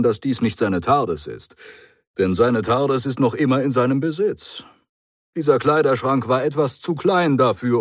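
brisk clever hero, a bit pleased with himself. Acted.

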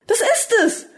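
very high-pitched cry of realisation; exclaiming. A narrator's reading. Doctor suddenly gets it from Rika's remark, exclaiming. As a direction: cry out as the idea strikes. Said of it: "rief er vor".

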